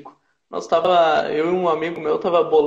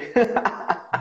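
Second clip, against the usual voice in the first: higher-pitched breath-filled laugh; 5 semitones higher than usual. Real, not acted. Joking about filling his glass at a friend's party, laughing. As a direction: burst out laughing after the joke.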